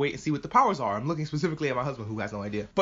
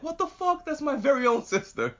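very high-pitched mock indignation; indignant protest; 10 semitones higher than usual. Brother defending his sister in the box, indignant. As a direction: squeak out a brother's offended protest.

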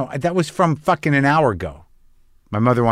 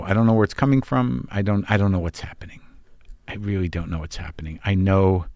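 ordinary talk, nothing marked

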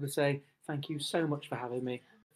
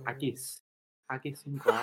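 practising a Scottish accent; Scottish accent warm-up; 5 dB quieter than usual. Shown as a demonstration. Actor getting into character, tentative Scottish accent. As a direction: try out Scottish sounds, feeling for the accent. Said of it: "Let me just get into character".

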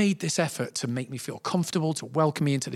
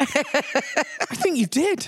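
slow, high realisation; 12 semitones higher, much slower than usual. Retold or imitated. Inner voice, slow verdict.

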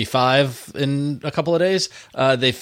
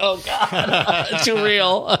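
laughing; 6 semitones higher, much slower than usual. Retold or imitated. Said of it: "And I'm like".

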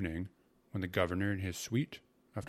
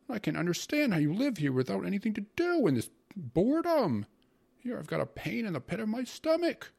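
high-pitched whining tirade, getting louder; sour, tearful complaint; 11 semitones higher, 6 dB louder than usual. A narrator's reading. Uncle moans about governor, boredom and stomach, tearful whining. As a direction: wail your grievances, building in volume. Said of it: "made a sour, tearful face and went on".

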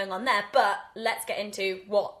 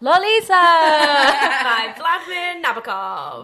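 dramatic announcement; 5 semitones higher, 11 dB louder, much slower than usual. Acted. Grand dramatic title announcement.

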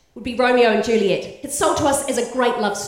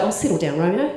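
eye-rolling Juliet; 6 semitones lower than usual. Pretend. Juliet on the balcony brushes off Romeo, bored and unimpressed. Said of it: "Juliet rolls her eyes".

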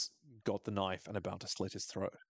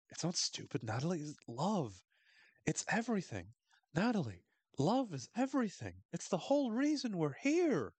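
hammed-up earnest plea, climbing steadily higher; rhapsodic coach; 7 semitones higher than usual. Coach consoling Natalie about love, rhapsodic. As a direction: build to a high, starry-eyed proclamation.

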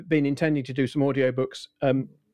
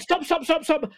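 mock alarmed doctor; 13 semitones higher than usual. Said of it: "And they said".

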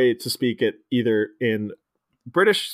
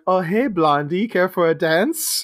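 creepy come-on; 5 semitones higher than usual. Retold or imitated. Mime guy hitting on Todd.

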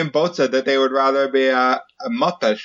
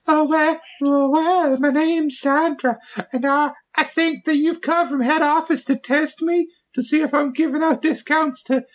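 put-on sweet Southern belle accent, pitched way up; 14 semitones higher than usual. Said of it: "you sound like a Southern belle".

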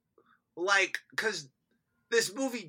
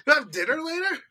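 louder, higher sugary voice; sugary fake sweetness; 9 semitones higher, 7 dB louder, much faster than usual. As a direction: sweet-talk with audacity. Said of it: "has the audacity to say".

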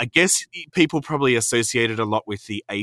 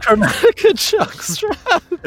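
dramatic announcement; 9 dB louder, much slower than usual. Acted. Grand reveal of new merch.

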